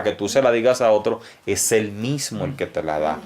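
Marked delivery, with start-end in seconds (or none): none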